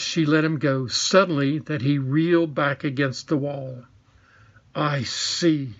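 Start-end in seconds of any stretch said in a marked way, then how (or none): 4.7-5.7 cold and scornful. A narrator's reading. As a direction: look down on him as you say it. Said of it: "she said scornfully".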